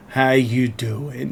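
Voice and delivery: slow, low, lightly voiced flirty dad; gruff dad voice. Streamer imitating shirtless dad villager, slow and low. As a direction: drawl it like a buff dad greeting you. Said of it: "pickaxe on his back and going".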